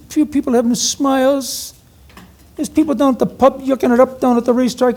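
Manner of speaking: high-pitched imitation Cork accent. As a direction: lilt it high in a mock thick Cork accent. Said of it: "almost indecipherable Cork accent".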